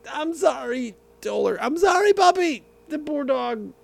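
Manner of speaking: high cooing apology to the puppy; high sweet dog voice. Real, not acted. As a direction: coo sorry up high like to a pet.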